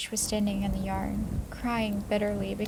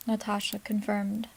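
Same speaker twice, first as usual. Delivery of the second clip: usual speaking voice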